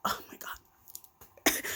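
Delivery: breathless whispered gasp of disbelief; hushed horror; shocked whisper. Real, not acted. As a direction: whisper it, stunned. Shocked reaction to story twist.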